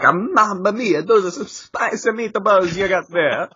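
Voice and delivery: high hammed-up Italian accent. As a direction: play it like a cartoon Italian. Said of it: "He's like".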